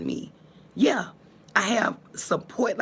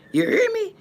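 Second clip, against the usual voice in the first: high laughing aside; 5 dB louder than usual. Real, not acted. Telling the girls about the gym, laughing. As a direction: laugh through it, much higher.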